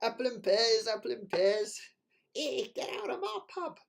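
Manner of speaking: tongue-in-cheek Cockney publican; mock cockney. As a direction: play a gruff Cockney landlord, over the top. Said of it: "West Country boys shouldn't do a cockney accent".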